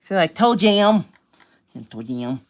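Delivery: ordinary talk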